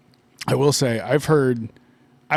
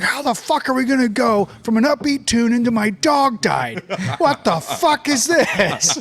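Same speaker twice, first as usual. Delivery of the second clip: imitated off-air outburst, high and loud; mock a radio host losing it mid-recording; exasperated radio host; 7 semitones higher, 5 dB louder than usual. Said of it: "he was like".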